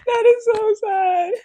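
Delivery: high, loud hammed-up crying, laughing into it; mock sobbing wail. Echoing her co-host's joke, hammed-up fake crying. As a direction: join in the fake bawling.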